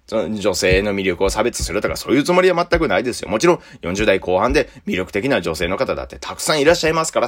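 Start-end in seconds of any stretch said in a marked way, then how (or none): none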